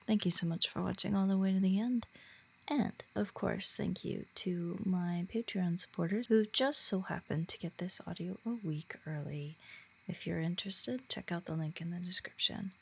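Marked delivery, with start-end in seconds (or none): none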